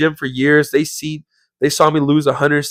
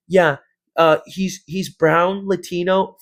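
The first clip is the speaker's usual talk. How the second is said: Karen; 4 semitones higher, slower than usual. Retold or imitated. Employee describing a member to police, mock woman's voice. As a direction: imitate her giving a description over the phone. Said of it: "on the phone and she's like".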